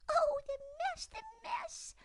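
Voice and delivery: very high, rising frantic squeak; excited squeak. Acted. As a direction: work into a tizzy. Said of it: "squeaked Dimity most excitedly".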